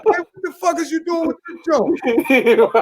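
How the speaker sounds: high, exasperated husband; mock confrontation. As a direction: ham up demanding answers.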